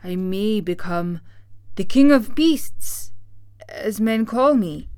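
big declaiming lion voice, swelling on key words; theatrical lion making his plea; Cowardly Lion. Acted. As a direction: plead grandly as a timid beast.

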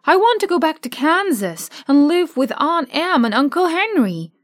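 put-on pleading homesick girl; high, longing child. Dorothy tells friends she longs for Kansas, pleading.

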